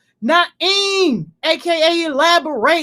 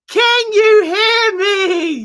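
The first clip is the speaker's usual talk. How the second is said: higher, slow drawn call; stretched calling out; 7 semitones higher, 4 dB louder, slower than usual. Real, not acted. Streamer repeating mic check, slow high call. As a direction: draw it out, calling to nobody.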